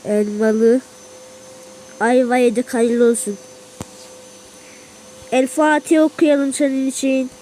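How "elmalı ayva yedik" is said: normal talking voice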